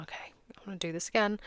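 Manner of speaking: fast, voiceless pep talk to yourself; hushed, quick "Okay" before the next room; whispered resolve. Pretend. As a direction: steel yourself under your breath before trying again. Hesitant self-talk before second try.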